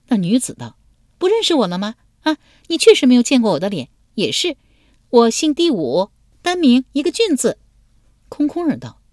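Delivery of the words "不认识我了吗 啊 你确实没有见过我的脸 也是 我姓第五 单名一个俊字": put-on high, lively girlish voice; pitched-up playful young woman; young woman's voice. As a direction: play the bold young lady teasing him.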